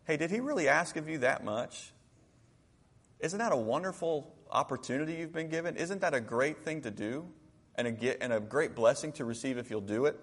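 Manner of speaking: softly voiced, tender persuasion; gentle counsel. Servant coaxing his angry master to obey, gentle counsel. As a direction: reason with him softly, as a loyal servant.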